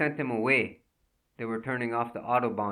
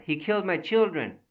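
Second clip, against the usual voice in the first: higher, loud cry of grief; anguished cry; 7 semitones higher, 4 dB louder than usual. Acted. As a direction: wail the accusation. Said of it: "she cried".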